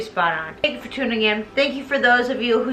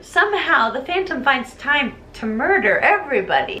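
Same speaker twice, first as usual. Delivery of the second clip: ordinary talk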